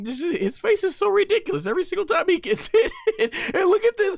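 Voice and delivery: high words broken by laughter; laughing. Real, not acted. Fan laughing at Goma's ridiculous face, high and giggly.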